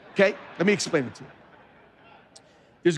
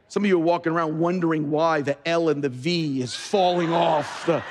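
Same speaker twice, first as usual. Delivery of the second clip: ordinary talk, nothing marked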